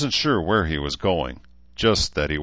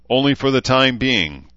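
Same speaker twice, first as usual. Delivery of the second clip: plain reading voice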